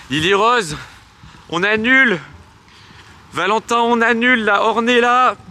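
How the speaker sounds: high, loud shouted call. Real, not acted. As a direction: shout across the park to call everyone back. Calling off the game to everyone.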